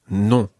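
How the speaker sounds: Non with a nasal French vowel. Shown as a demonstration. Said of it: "a nice nasal sound".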